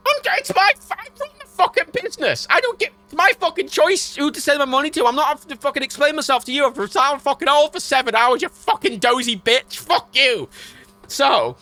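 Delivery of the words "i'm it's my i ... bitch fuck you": high, loud furious tirade; ranting, shouted outrage; very angry. Retold or imitated. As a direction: replay the blow-up at full steam. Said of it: "got very angry".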